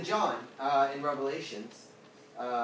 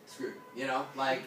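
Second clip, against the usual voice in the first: ordinary delivery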